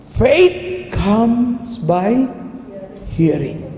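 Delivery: drawn-out, weighty verse; slow proclamation. Shown as a demonstration. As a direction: let each word land slowly.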